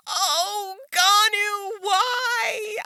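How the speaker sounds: high, drawn-out lament; dismayed wail. Real, not acted. Fan mourning Gonu killed in the game, dismayed wail. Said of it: "that's sad".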